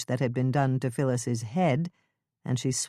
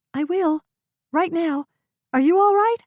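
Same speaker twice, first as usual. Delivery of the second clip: worried Lisa; 7 dB louder than usual. Acted.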